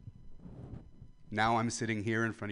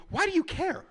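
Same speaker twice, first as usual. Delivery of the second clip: indignant shout; 4 dB louder than usual. Real, not acted. Ranting at the crowd.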